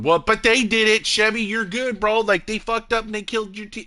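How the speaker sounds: high-pitched egging-on chat voice; people in chat. Retold or imitated. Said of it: "you got people in chat going".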